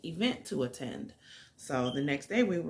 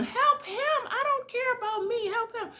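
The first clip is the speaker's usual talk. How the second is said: high, desperate retold cries; frantic pleading scream. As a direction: imitate a woman begging, pitched way up. Frantic girl begs for help.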